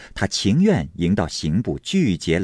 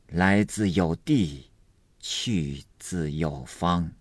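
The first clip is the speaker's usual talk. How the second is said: very slow, drawn-out sage voice, sinking softer; cryptic old Taoist; 2 semitones lower, 4 dB quieter, slower than usual. Acted. Taoist answers the official's questions, cryptic and slow. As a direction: stretch each phrase like a riddle.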